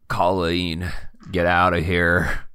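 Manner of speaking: low, earnest figure voice; low, resigned plea in character; self-sacrificing wounded man. Acted. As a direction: urge a companion to leave, voice dropped low. Voices wounded Amos urging Colleen away.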